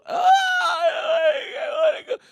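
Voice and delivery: put-on high, drawn-out whining child; whiny kid. Son whining to dad about Disneyland, high and slow. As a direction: beg like a little kid who wants to go.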